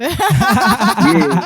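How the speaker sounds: high, laughing yeah. Real, not acted. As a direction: burst out laughing on the word. Laughs at crip walking story.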